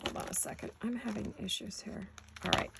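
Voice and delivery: muttered under her breath; whispering. Real, not acted. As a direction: whisper to yourself while fiddling. Muttering to herself while fixing.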